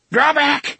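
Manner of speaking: high, loud breathless warning; panting. A narrator's reading. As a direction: pant it out. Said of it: "he panted".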